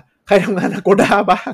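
high, loud and slow call across the mall; calling out to a crowd of office workers, loud and slow; retold shout. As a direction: shout the question out to the whole floor. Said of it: "ตะโกนว่า".

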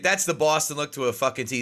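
normal talking voice

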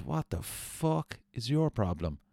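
imitating a rocker losing his temper; angry retort. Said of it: "he just pulled his hand back and goes".

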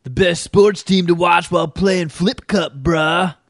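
sung frat bro hype line; mock frat bro. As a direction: croon it like a dumb frat bro. Mocking frat bro sports pitch.

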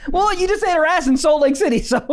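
laughing, high teasing. Real, not acted. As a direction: tease while cracking up. Teasing punchline while laughing.

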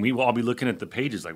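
ordinary delivery

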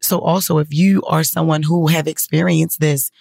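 ordinary delivery

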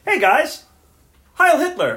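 high, loud hammed-up salute; mock Rolf greeting with a Nazi salute; imitating Rolf. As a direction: greet them cheerfully, then shout the salute. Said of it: "starts off the second half with".

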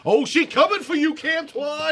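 high-pitched hype man taunt. Pretend. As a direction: hype her up like a ringside fan. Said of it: "I am gonna be Rin's hype man".

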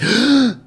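sharp high gasp of alarm; shocked gasp. Retold or imitated. Range guy sees a new pistol dropped, shocked gasp. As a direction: gasp like you just saw a gun hit the ground.

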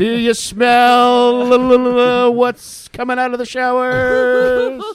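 loud, high, very slow wrestler catchphrase; The Rock impression. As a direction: drag it out like a hammy pro wrestler. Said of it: "That horrible Rock impression".

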